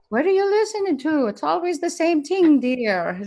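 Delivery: high, lilting Irish nurse; teasing Irish-accented quote. Retold or imitated. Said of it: "I like the accent".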